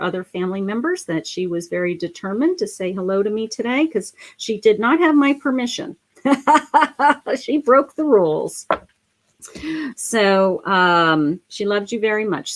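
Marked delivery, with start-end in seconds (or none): 6.2-7.3 laughter. Real, not acted. Laughing at spirit breaking rules.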